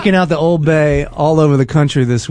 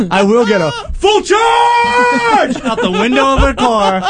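laughing; 7 semitones higher, slower than usual. Real, not acted.

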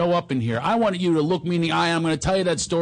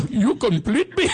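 put-on higher, slow comic Indian accent; movie line in a mock Indian accent; 6 semitones higher, slower than usual. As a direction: do it as a hammed-up Indian. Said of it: "an Indian".

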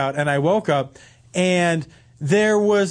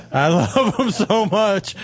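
laughing through the words; slower than usual. Real, not acted. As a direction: gush with a laugh. Host gushing over cupcakes, laughing.

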